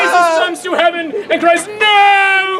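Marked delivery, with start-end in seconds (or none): 1.7-2.6 mock anguished cry. Shown as a demonstration. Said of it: "That was acting".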